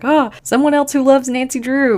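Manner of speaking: high, loud burst of delight; excited. Retold or imitated. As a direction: gush like a thrilled fan spotting another. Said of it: "I was just really, really excited".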